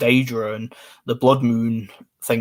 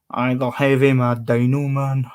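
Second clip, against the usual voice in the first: playful put-on Glaswegian accent; Glasgow accent; slower than usual. Streamer still playing his Glasgow self, mock Glaswegian. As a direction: answer in mock Glasgow slang. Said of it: "That's enough of that".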